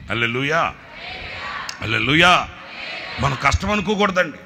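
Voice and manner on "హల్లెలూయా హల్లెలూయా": preaching exclamation. Real, not acted. Preacher rousing the congregation, fervent exclamation. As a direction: proclaim it to the congregation.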